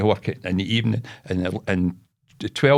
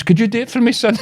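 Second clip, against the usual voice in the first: put-on high, louder old-lady plea; mock helpless old lady; wee old woman; 13 semitones higher, 4 dB louder, faster than usual. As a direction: imitate an old woman asking a favour, pitched up. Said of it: "this wee old woman".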